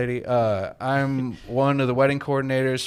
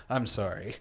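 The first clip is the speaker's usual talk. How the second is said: ordinary talk, nothing marked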